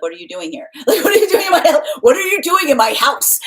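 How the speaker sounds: laughing, high indignant squeal; mock indignation at intruders in my house; mock territorial resident. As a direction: play it as an outraged homeowner, half laughing. Mars imagined scolding intruders.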